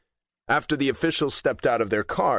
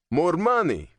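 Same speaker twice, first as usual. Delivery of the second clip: higher, pushed protesting growl; 7 semitones higher than usual. A narrator's reading. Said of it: "he growled in protest".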